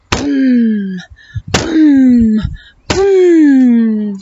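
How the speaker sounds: low, heavy boom; low, loud footstep booms. Acted. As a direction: thump out the giant's steps. Giant footsteps imitated.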